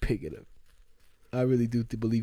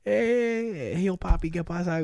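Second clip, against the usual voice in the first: a higher, playful Spanish-speaking parrot; parrot talking Spanish; 4 semitones higher, slower than usual. Retold or imitated. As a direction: greet him like a cheeky parrot, higher up. Parrot voiced greeting in Spanish.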